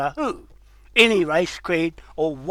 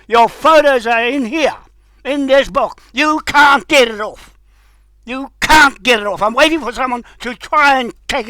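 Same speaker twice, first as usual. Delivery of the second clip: angry rant; 5 semitones higher, 4 dB louder than usual. Real, not acted. Angry rant aimed at politicians.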